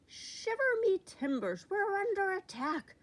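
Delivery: high, loud pirate bellow; bellowing pirate captain. A narrator's reading. Captain to his crew, loud alarmed bellow. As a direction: roar it out like a startled pirate. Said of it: "bellowed Captain Fishbeard".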